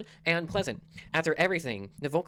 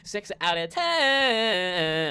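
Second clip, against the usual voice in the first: slow, loud, drawn-out announcer; dramatic announcer voice; 5 semitones higher, 6 dB louder, much slower than usual. Shown as a demonstration. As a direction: announce the score like a showman. Score repeated louder, odd voice.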